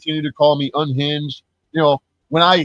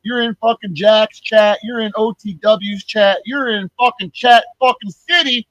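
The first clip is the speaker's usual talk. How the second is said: ranting call-out; 6 semitones higher than usual. Real, not acted. Calling out lurkers in a rant.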